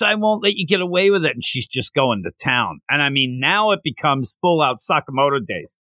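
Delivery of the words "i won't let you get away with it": mock yell. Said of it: "she's yelling about this".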